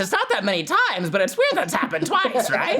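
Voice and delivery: high nasal cartoon villain voice; whiny mad scientist, way up high; Doofenshmirtz voice. You're incredibly good at that voice.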